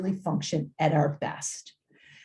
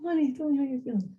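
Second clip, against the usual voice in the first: mock sweet parent; 6 semitones higher, 4 dB quieter, faster than usual. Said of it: "you cannot sit down and say".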